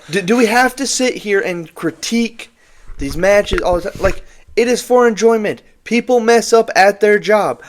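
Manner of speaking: high-pitched indignant venting; exasperated rant. Real, not acted. Ranting at online match critics.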